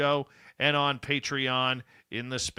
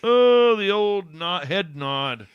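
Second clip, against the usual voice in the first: excited exclamation; 7 semitones higher, 11 dB louder, slower than usual. Real, not acted. Laughing at tired co-host.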